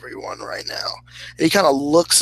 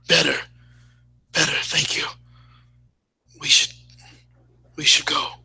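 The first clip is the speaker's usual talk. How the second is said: weak whisper; faster than usual. Acted. Wounded sailor recovering, whispers thanks.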